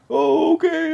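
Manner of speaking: very high, loud, drawn-out mock fear; mocking reply. Corpsmen brushing off the patient's threat, mocking. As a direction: stretch it high to belittle the threat.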